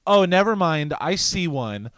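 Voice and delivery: high, loud dismissive imitation; mock complaining woman. Woman brushing off venue worker, mock huffy. Retold woman spots security, acted.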